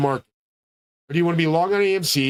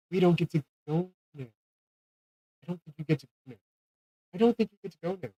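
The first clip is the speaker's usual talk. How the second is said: much softer, fading mock quote; small mock voice; 7 dB quieter than usual. Mimicking those who don't get to go, small and quiet. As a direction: say it small, like the disappointed left behind.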